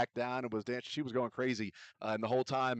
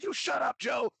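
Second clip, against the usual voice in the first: much higher mock yell at Joe; a jokey mock yell, much higher; retold shout; 4 dB louder than usual. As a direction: throw it back at him in a high teasing voice. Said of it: "I said".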